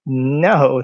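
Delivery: normal talking voice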